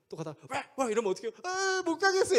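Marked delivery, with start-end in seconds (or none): 0.5-0.9 short, sudden mock barks; imitated dog bark. Dog barking when the boy tries once more, imitated barking. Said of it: "막 짖는 거죠". 1.4-2.4 put-on high, loud childish wail; frightened child. Scared son telling father he cannot go, frightened wail. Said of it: "이렇게 하는 거예요".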